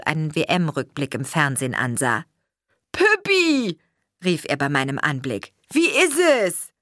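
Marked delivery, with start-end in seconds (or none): 2.9-3.8 high, louder joyful call of a pet name; light up at the sight of her; delighted father. A narrator's reading. Father greeting his daughter, high happy call. 5.7-6.7 eager father. A narrator's reading.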